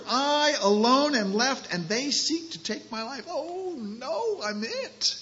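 a frightened prophet cornered and alone; panicked despair. Acted. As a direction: voice climbing into alarm. Elijah voiced in despair.

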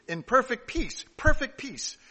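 "perfect peace" said high and loud; emphatic repetition. Shown as a demonstration. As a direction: hit "perfect peace" hard, falling at the end. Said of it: "That is an emphasis on the word peace".

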